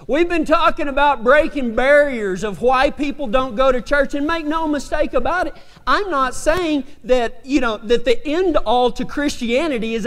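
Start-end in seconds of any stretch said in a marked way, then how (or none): none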